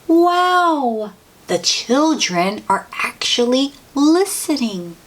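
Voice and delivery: amazed wow. Acted. Reader delighted that the children listen, amazed. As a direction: marvel that they listen.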